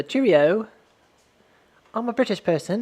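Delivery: mock posh British voice; posh British accent. Shown as a demonstration. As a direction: put on an upper-class British accent. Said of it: "that posh British accent".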